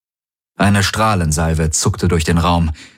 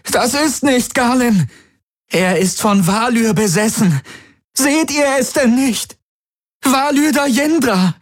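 desperate screaming. A narrator's reading. Kirai struggling in Tari's grip, frantic high yelling. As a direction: fight and beg them to see the truth.

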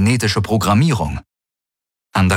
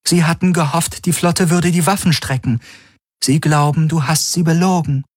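raised, even machine-like voice; ship AI relaying a message; 9 semitones higher than usual. Acted. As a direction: report the enemy's reply coolly.

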